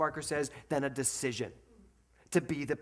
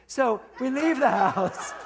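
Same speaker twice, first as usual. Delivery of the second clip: laughing through the words, slow; 9 semitones higher, 8 dB louder, slower than usual. Real, not acted. Telling the congregation about leaving home, laughing. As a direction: giggle the line out slowly.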